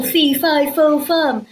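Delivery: loud mock giant chant; imitating the giant. Jack retelling the giant to mum, mock loud. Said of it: "was all".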